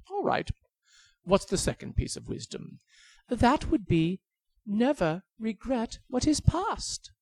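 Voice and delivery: high pleading bird voice; tiny high bird begging for its life. Acted. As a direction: squeak like a begging little bird.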